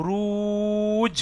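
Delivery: রুজ stretched long, landing on the জ; drawn-out ruj. Shown as a demonstration. Teacher showing student drawn-out রুজ. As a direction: slowly pull রুজ out and catch the জ. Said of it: "টেনে এসে জিম ধরতে হচ্ছে".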